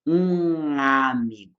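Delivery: slow um amigo with a nasal ng joining the words; nasal linking. Shown as a demonstration. Teacher showing Portuguese nasal link, slow pron. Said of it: "Esse nga, esse som mais ou menos assim, nasal e estranho".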